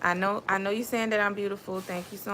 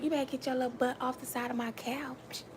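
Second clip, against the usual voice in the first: soft, under-the-breath telling-off; hushed scolding; 4 semitones higher, 7 dB quieter than usual. Real, not acted. Mother to child climbing on her couch, hushed scolding.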